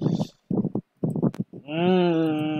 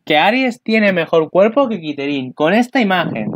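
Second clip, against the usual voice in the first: louder and higher, worked up in disbelief; excited loud protest; 6 semitones higher, 6 dB louder than usual. Real, not acted. To viewers, arguing about the preview's body comparison, excitedly loud. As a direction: push back hard, almost shouting.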